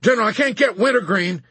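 high, loud colonel's shout; urgent yell to the general. A narrator's reading. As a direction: shout the bad news across. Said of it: "Colonel Cargill shouted".

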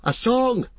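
high-pitched hobbit shout; shouted demand. A narrator's reading. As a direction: call out eagerly across a room, pitched high. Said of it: "shouted one of the hobbits".

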